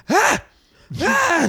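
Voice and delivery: high squealing baby cry, imitated; mock baby wail. Father imitates his baby daughter's wail, high squeal. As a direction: squeal like an upset baby girl.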